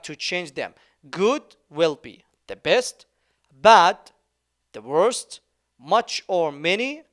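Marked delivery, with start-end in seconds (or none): 3.5-4.0 loud drill word. Shown as a demonstration. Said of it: "teacher drills irregular forms".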